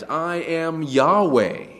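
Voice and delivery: "I am Yahweh" high, loud and drawn out; emphatic refrain. Acted. Preacher quoting God's repeated phrase, "I am Yahweh" slowly proclaimed. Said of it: "Why does he repeat the phrase".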